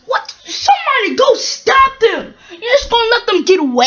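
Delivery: high, loud, outraged yelling; cross shouting. Acted. Seeing engines get away, shouting crossly. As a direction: shout crossly, rushing the last question. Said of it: "he said crossly".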